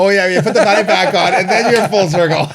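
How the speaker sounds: loud, high, laughing exclamation; real boisterous laughter in the words. Real, not acted. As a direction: crack up while calling it out. Laughing loudly at fanny pack callback.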